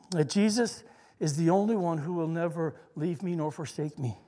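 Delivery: normal talking voice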